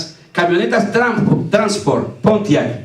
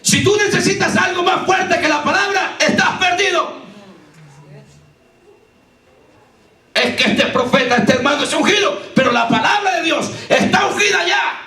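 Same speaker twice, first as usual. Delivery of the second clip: full-force preaching; 7 semitones higher than usual. Acted. Preacher testifying to the congregation, full-force preaching. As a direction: preach it out with rising fervor.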